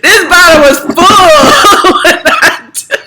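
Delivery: tipsy, laughing through the words. Real, not acted. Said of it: "you know I'm drunk".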